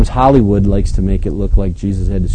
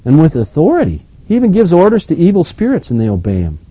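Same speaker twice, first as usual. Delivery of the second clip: amazed; 5 semitones higher than usual. A narrator's reading. Amazed people asking each other about Jesus, astonished. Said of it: "the people were so amazed".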